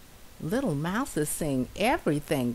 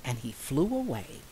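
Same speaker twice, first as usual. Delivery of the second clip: plain reading voice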